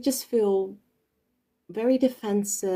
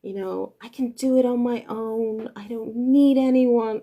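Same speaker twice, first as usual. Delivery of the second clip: louder, higher mock me-me-me brat; mocking selfish person; 4 semitones higher, 6 dB louder than usual. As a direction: mimic a self-centred know-it-all who needs no one. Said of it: "it's always like".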